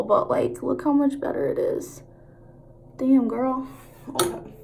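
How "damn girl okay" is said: talking from side of mouth; lopsided, half-closed mouth; out of mouth corner. Real, not acted. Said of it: "I talk out of the side of my mouth".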